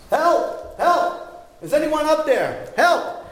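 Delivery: high desperate shout up a cliff; yelling for help. Acted. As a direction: shout for help with everything you have. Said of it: "he yelled for a long time".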